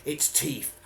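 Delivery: usual speaking voice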